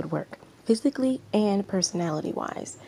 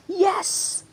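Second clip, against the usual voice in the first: high, loud burst of triumph; cheering; 7 dB louder than usual. Acted. Manager cheering as the girl finally agrees. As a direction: cheer it out, thrilled. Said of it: "pulled back from cheering out loud".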